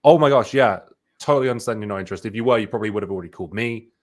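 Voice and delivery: loud burst of agreement, then quick; fast, emphatic reassurance. Shown as a demonstration. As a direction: agree wholeheartedly and rush on. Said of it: "emphatically agree with them".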